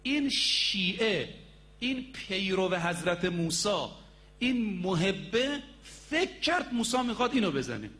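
usual speaking voice

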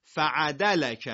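slow, run together as one word "فعدلک"; joined up. Shown as a demonstration. Teacher showing the class how it joins up, slowly blended.

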